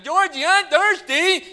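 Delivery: high, slow loud holler; real loud. Acted. Pretend brother in the pop aisle, real loud. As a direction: drag it out in a high put-on yell. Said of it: "real loud".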